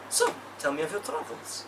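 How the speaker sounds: a soft hint of a Russian accent; quiet, light Russian lilt; lightly Russian barmaid. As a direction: lean in and purr in faint Russian. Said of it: "taken on a kind of Russian accent".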